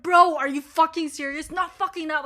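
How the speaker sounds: loud, incredulous yell at the app; exasperated outburst. Retold or imitated. Retelling to viewers her yell at the laptop, loud and exasperated. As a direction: vent at the stupid update at full voice. App updates while joining meeting.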